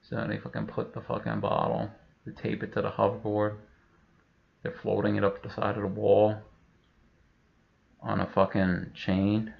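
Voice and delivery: ordinary delivery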